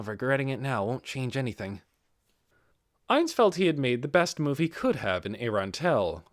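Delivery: ordinary delivery, nothing marked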